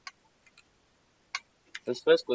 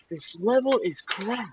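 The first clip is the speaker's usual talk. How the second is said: much higher, slow, cartoonish squeak; high squeaky voice; 13 semitones higher, 5 dB louder, slower than usual. Repeats complaint in high silly voice.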